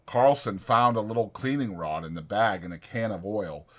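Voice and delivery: plain reading voice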